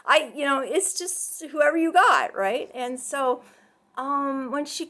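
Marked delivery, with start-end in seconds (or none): none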